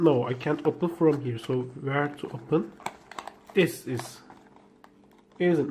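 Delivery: usual speaking voice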